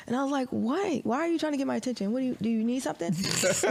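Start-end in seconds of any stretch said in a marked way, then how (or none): 0.5-3.1 fast, higher, confused questions; baffled rapid questions to a stranger. Retold or imitated. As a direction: fire the questions off quickly. Retold reply to the stranger.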